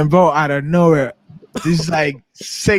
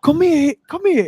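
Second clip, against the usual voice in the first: calling out; 11 semitones higher than usual. Retold or imitated. Calling someone over, high.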